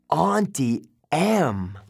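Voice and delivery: slow, drawn-out realization; horrified recognition; dawning horror. A narrator's reading.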